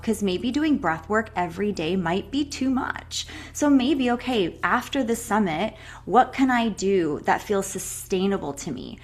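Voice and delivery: ordinary talk, nothing marked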